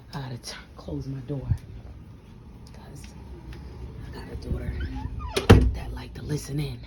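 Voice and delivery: low, near-whispered aside; hushed confiding. Real, not acted. Parent wary of a daughter listening in, hushed. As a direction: drop your voice so nobody outside hears.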